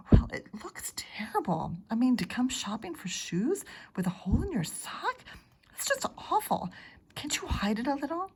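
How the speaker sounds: hushed, urgent whisper; embarrassed whisper. Acted. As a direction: whisper it so nobody in the store hears. Mother embarrassed by the holey sock.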